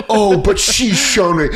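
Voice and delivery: high mocking imitation of dad. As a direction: lovesick dad, mocked in a high voice. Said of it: "where he goes".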